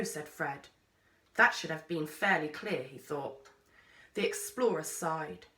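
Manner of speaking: usual speaking voice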